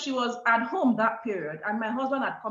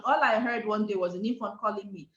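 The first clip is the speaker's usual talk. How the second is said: ordinary talk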